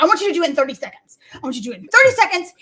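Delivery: fast, pushy repeated order; imitating a boss, fast; rapid mock demand. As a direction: rattle it off quickly, twice. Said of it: "He's like".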